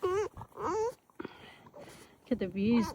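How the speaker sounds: ordinary talk, nothing marked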